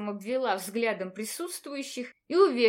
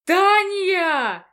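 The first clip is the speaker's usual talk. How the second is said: yelling; 7 semitones higher, 11 dB louder than usual. Acted. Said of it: "заорал декан".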